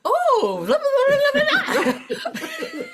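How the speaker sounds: high, loud mock French patter; a burst of high, loud imitation French talk; rapid French chatter. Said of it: "and they go".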